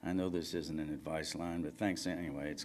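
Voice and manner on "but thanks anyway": ordinary delivery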